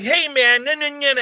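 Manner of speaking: high, loud whiny imitation of a sidekick; a nasal-free but squeaky mock protest; mocking friend voice. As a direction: mimic a whiny sidekick, pitched well up. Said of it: "he starts going like".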